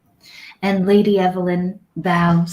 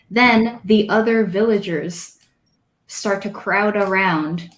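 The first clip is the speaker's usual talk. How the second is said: normal talking voice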